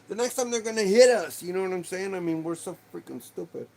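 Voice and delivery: venting real frustration about the asteroids; worked-up outburst that cools by the end; getting mad. Real, not acted. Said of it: "I'm getting mad".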